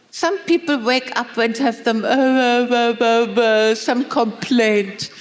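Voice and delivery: hesitant, halting complaint; mock grumbling. Preacher mimics a grumbler who wakes up complaining.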